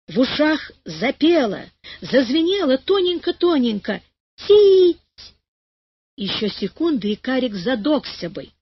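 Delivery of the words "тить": high ringing. A narrator's reading. Ringing in the ears.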